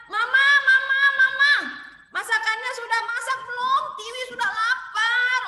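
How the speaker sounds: put-on high, loud whiny child calling Mama; child yelling for mom. Hungry child shouting to mom from her room, high and loud. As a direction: yell from the bedroom like a hungry child. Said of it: "Tiwinya teriak-teriak di kamar".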